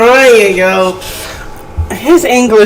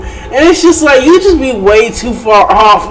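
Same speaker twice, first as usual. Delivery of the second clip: ordinary delivery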